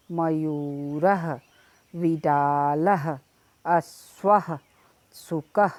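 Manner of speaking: put-on slow, syllable by syllable Sanskrit words for children to repeat; slow clear pronunciation. Shown as a demonstration. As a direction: spell out each word slowly so the class can echo it. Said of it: "यहाँ मैं उच्चारण करती हूँ और आप मेरे साथ-साथ बोलें".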